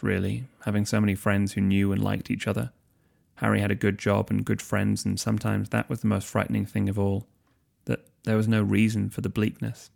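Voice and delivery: ordinary delivery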